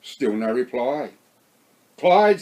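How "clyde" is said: a loud, high call of his name; yelled. A narrator's reading. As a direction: shout his name into the dark. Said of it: "Sandy yelled".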